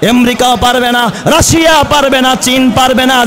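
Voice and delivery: ordinary talk, nothing marked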